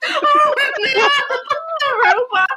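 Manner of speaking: high, squealing laugh through mock panic; half-laughing, half-wailing at a scary robot; laugh-crying mock panic. Retelling the robot scare to friends, high laughing mock wail. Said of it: "I started crying".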